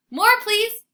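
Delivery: very high, loud begging; excited dog begging; eager bark. A narrator's reading. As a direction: beg like a puppy. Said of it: "I bark".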